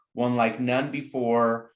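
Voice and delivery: usual speaking voice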